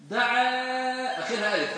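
دعا said slowly, with a long open final alif; teacher stretching the long alif at the end of دعا; slow stretched alif ending. Shown as a demonstration. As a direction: draw out دعا so the ending rings as a clear long aa. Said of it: "فأحيانًا تقول".

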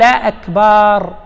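"أكبر" misread slowly as "akbaaar", long aa after the b; lengthened takbir vowel. Shown as a demonstration. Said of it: "فإن مد همزة الله أو أكبر".

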